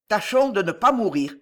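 ordinary delivery

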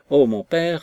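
ordinary delivery, nothing marked

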